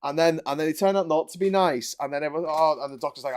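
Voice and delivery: normal talking voice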